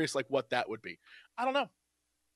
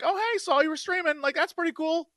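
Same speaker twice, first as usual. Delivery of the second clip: friendly greeting; 9 semitones higher than usual. Retold or imitated.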